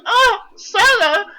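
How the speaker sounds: high, slow mock wail of a helpless girl; mocking Kairi's feeble cry; mock damsel cry. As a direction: ham up a helpless cry for help. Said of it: "and she's like".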